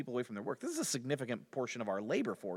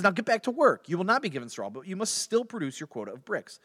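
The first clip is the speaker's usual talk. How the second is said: raised, loud opening, easing off; stern royal order; 8 semitones higher, 8 dB louder than usual. Retold or imitated. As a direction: order them back like an acted ruler. Pharaoh's order acted out.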